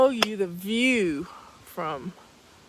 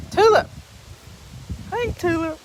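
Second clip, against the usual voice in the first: calling a pet; 7 semitones higher, 6 dB louder than usual. Real, not acted. Greeting the dog.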